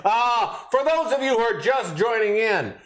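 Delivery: high-pitched, playful announcing; hammed-up welcome for latecomers; mock announcement. Mock announcement to viewers joining in.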